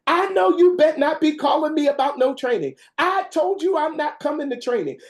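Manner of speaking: high, loud indignant outburst at the caseworker; angry grandmother yelling. Retold or imitated. Grandmother refusing training yells at caseworker, high and loud. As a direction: bark it like a furious grandma who won't be trained. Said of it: "scream and holler".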